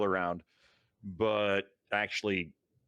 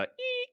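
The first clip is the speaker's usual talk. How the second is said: high-pitched imitated radio squeal; short high eek of static; high squelch noise. Shown as a demonstration.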